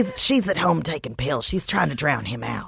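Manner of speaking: low, soft accented impression; lightly put-on accent, amused; Trish's accent. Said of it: "Please do Trish again".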